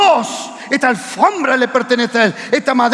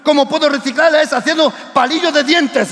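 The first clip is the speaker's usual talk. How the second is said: normal talking voice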